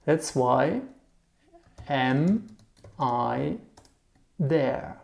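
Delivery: "That's why... am... I... there" with long pauses; word by word. Shown as a demonstration. Teacher translating the German word order, word by word.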